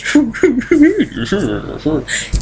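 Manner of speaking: high-pitched gleeful cackle, put on; mock giddy villain laugh. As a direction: cackle like an over-cheery villain. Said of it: "He's not sick like the Joker".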